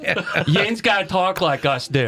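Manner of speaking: slow, broad Pittsburgh dialect; Pittsburgh Yinzer accent. Shown as a demonstration. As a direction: lay on a drawn-out Yinzer accent. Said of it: "Is that the accent?".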